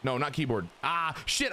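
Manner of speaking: ordinary delivery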